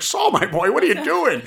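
put-on high, loud, laughing old man's protest; startled old man. Old animator losing grip on the portfolio, laughing squeal. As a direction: squeal it out mid tug of war. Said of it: "He's like".

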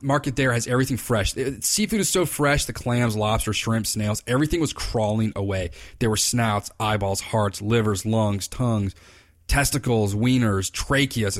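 ordinary delivery

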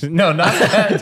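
high, loud laughing protest. Real, not acted. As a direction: laugh through the denial.

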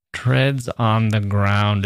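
very slow, each word weighted; heavy lumbering. Shown as a demonstration. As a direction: plod through it like a truck on heavy wheels. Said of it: "lumbering along with heavy wheels".

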